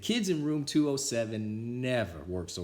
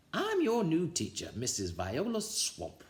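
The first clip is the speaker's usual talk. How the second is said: stern witchy teacher; 7 semitones higher than usual. A narrator's reading.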